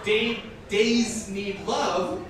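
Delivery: slow, drawn-out mock dialect verse with laughs breaking through; slow goofy verse, laughing through it; comic poem reading. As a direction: read the silly cat poem slowly, fighting the giggles.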